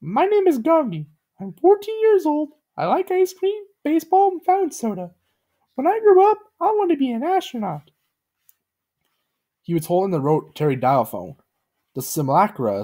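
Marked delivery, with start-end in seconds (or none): 0.0-7.9 very high cartoon boy voice; squeaky innocent recital; Gumby's high childlike voice. A narrator's reading. Gumby states his name and dreams, high voice.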